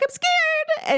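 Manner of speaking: put-on high, small scared child voice; imitating a frightened daughter; scared little girl. Mom retelling her daughter's whimper. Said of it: "She's like".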